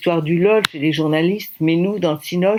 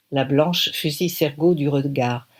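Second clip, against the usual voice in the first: usual speaking voice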